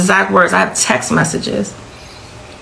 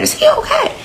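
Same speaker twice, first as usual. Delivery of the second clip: very high, strained echo of the question; mother imitating her own frantic cry; retold frantic question. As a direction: relive the panic, voice shooting up. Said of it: "It's the first thing I said".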